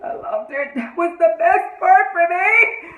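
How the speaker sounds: high, loud, drawn-out squeal; fan gushing. Real, not acted. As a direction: gush at full volume, stretching the words. Fan gushing over favourite scene.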